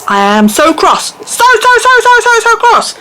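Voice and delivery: very loud, high mock outrage; loud high mock cross. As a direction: play up being furious.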